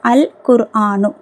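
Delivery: "அல் குர்ஆனு" with the L clearly sounded; lam pronounced. Shown as a demonstration. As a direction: give அல் its full L in "அல் குர்ஆனு". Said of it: "லாமை வந்து உச்சரிக்கணும்". ("அல் குர்ஆனு" லாம் உச்சரித்து)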